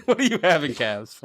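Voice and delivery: laughing through the question. Real, not acted. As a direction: ask it while laughing.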